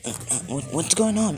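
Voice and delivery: stammering, jumping high and loud; acted bewildered outburst climbing sharply; startled confusion. Deku immediately put into handcuffs, startled confusion.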